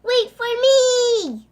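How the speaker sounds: slow, high, loud call; desperate call. Acted. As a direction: stretch it out calling after her. Frog chasing Poppy.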